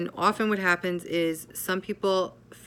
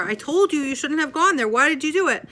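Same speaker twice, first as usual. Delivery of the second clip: high, loud scolding shout; angrier yelling; 10 semitones higher, 6 dB louder than usual. Acted. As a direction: bawl the child out. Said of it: "the parent yells even more".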